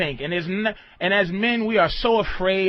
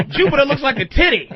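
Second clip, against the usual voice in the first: mock radio answer; 7 semitones higher, 5 dB louder than usual. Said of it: "mock radio answer".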